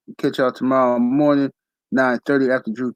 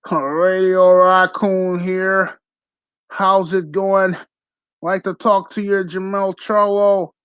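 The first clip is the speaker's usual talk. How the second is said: slow, drawn-out, higher mock broadcaster intro; mocking announcer voice; 5 semitones higher, much slower than usual. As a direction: ham up a stiff TV boxing host. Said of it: "trying hard as hell to do that voice".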